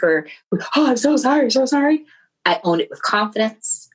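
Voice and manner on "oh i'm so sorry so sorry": meek apology. Retold or imitated. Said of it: "or be very meek".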